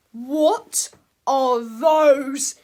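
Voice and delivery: very slow, loud, drawn-out gasp of a question; stunned at the eggs; amazed cry. Acted. George cries out at the eggs in the nest, slow and loud. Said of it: "he cried".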